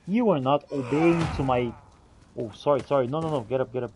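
ordinary talk, nothing marked

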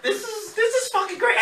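high laughing gush; squeaky delight. Retold or imitated. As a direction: squeak it like a giddy kid. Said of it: "I'm just like".

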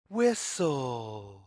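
ordinary delivery

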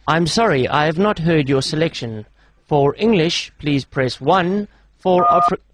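low, slow recorded-operator voice; automated phone menu. Pretend. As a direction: read out menu options slowly and evenly.

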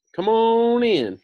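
high, loud and slow wizard call; the great Oz calls the visitor in; Oz's voice. A narrator's reading. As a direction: boom it out grandly from the throne. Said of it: "said Oz".

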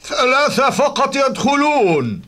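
guard's shout. Acted. Guard turning people away at gate.

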